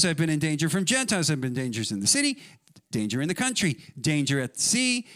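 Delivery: high, driving litany of hardships; forceful scripture reading. Acted. Preacher voicing Paul's sufferings for the church, forceful recitation. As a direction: hammer out each item in the list.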